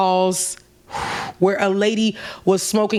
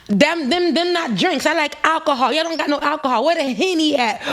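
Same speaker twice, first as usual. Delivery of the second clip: high brash tourist voice; pitched-up mocking rant; going in; 6 semitones higher than usual. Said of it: "just, like, going in".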